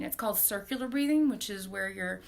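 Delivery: usual speaking voice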